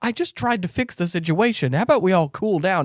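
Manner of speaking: high-pitched defensive pleading; mock placating. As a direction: imitate someone trying to calm things down. Acted reply to being yelled at.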